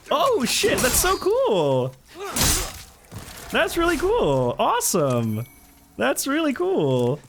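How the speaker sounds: high, drawn-out thrilled exclamations; excited. Real, not acted. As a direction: gush with real amazement, slowly. Hearing the raider voiced the game.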